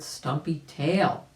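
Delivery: ordinary delivery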